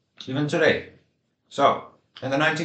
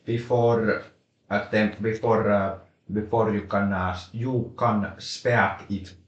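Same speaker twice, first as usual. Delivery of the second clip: rally English accent. Shown as a demonstration. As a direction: speak English like a Finnish rally driver. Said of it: "rally English is spoken like this".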